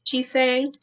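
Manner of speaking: put-on lively read-aloud for children; story narration. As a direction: read it like a picture book to kids. Teacher reads picture book aloud.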